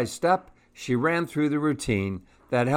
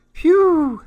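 very high, loud "phew"; whistle-high sigh of relief; squeaky exhale of relief; relieved phew; 7 dB louder than usual. A narrator's reading. Relieved character in story.

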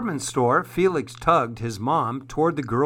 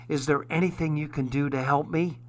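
ordinary delivery, nothing marked

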